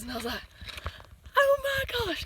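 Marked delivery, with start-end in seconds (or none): 1.4-2.2 a sudden high gasp of surprise; a much higher, startled oh my gosh; surprised. Retold or imitated. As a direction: jump up high, caught off guard. Surprised by a friend behind her.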